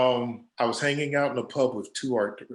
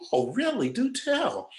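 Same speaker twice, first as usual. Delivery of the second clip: high, sing-song feigned interest; arch, teasing do tell; mock intrigue; 12 semitones higher than usual. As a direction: egg them on with fake fascination. Feigned interest at drunk pitch.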